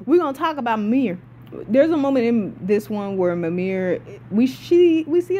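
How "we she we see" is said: excited stumble over the first words; stuttering. Real, not acted. Excited fan telling viewers about Mimir, stuttering. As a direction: trip and restart mid-sentence. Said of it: "I don't know why I keep stuttering".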